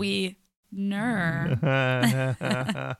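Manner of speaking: drawn out. Pretend. Drawn-out pun on wiener.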